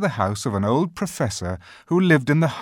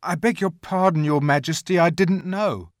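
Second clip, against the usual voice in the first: put-on apologetic boy; 8 semitones higher, 6 dB louder than usual. A narrator's reading. Edmund apologising to the queen.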